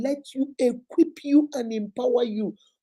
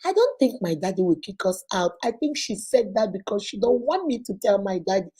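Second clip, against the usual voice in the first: normal talking voice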